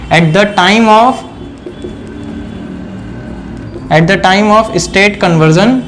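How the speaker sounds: very slow, phrase by phrase; stretched words, waiting for note-takers; slow dictation. Real, not acted. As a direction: dictate the rule with long pauses. Dictating a rule to students.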